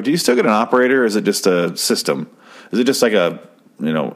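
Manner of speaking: usual speaking voice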